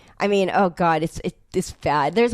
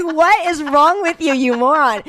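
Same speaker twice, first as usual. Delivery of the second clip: slow, higher and louder with laughter; laughing exasperation; 8 semitones higher, 5 dB louder, slower than usual. Retold or imitated. Snapping at the co-star, laughing slowly. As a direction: drag it out, laughing in disbelief.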